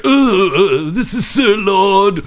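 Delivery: put-on slow, stuffy British accent. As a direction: play a pompous little British caller. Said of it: "Like a little British guy there".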